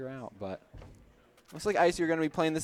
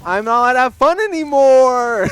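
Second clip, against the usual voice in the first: mock whine; 8 semitones higher, 15 dB louder than usual. Real, not acted. Joking complaint after co-caster's scolding.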